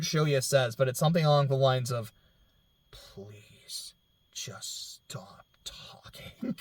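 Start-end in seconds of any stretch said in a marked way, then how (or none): 2.9-6.6 soft whisper, slowed on stop talking; whispered quote. Retold or imitated. Quoting Shoya to Miki, in a whisper. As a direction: breathe it out like a tired plea.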